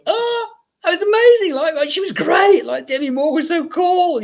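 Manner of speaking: high, breathless starstruck gushing; giddy. Retold or imitated. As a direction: gush like starstruck fans after the star leaves. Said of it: "a bit giddy".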